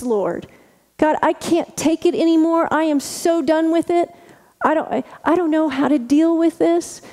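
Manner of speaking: heartfelt lament voiced high; lamenting prayer. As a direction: pour out the frustration to God.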